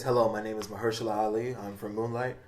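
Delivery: a hushed, suave introduction; gently intoning his own name; imitating Mahershala. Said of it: "When that man gets on T V, he says".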